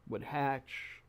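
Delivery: normal talking voice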